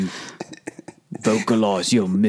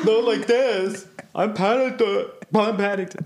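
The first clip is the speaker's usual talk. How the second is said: laughing bear voice; 8 semitones higher than usual. Retold or imitated. Mimicking Paddington with laughter.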